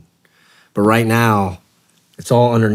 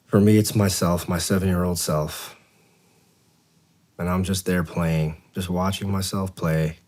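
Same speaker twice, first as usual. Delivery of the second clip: guided meditation. Acted. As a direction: talk them gently down to the beach.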